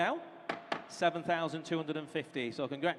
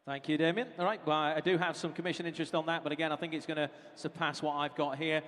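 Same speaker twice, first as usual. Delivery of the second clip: normal talking voice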